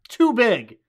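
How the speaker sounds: too much, too big; loud reaction. Retold or imitated. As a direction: call it out like a heckler in the cinema. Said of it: "I'm like".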